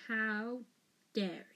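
slow, measured growl; each word held apart, understated menace. A narrator's reading. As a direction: growl it with pauses between words. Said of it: "he growled".

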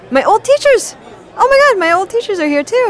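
high, loud delighted gush; thrilled to spot old friends; excited squeal. Acted. Spotting old teachers at party.

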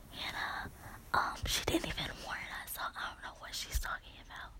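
soft unvoiced whisper to a friend in class. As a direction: hush it so the teacher can't hear. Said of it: "I'm whispering even lower to Hannah".

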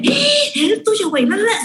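high squealed tease, sing-song la la la; high mocking squeal. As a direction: taunt your friend, sing-song. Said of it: "después fue como de".

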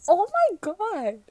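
loud, high, drawn-out oh my god; gasp, then cry out in sudden realization; shocked gasp. Real, not acted. Sudden realization about her own word.

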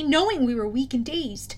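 ordinary delivery

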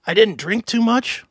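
ordinary talk, nothing marked

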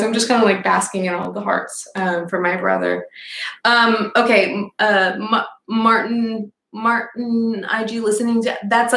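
ordinary delivery

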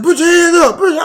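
high, loud barked order; imitated shouted command. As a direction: mimic someone yelling orders. Imitates shouted order heard on phone.

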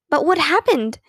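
impatient. A narrator's reading. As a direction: press for the answer, hardly able to wait. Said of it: "Beezus could hardly wait to find out".

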